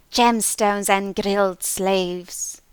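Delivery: slow, loud, eerie creature voice; creature nonsense. A narrator's reading. Hidden creature answering the clerk, slow eerie voice. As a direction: draw out the nonsense like a spirit.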